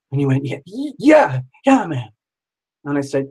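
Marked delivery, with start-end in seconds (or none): 0.4-2.1 higher, stammered agreement; imitating an excited man agreeing. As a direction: stammer into an eager, high yes. Psychic excitedly agrees in retelling.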